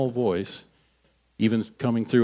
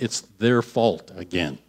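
ordinary talk, nothing marked

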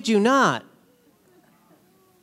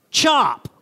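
a sharp chainsaw chop, higher; chop sound; 6 semitones higher, 3 dB louder than usual. Shown as a demonstration. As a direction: cut it like a chainsaw. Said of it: "This is how it works".

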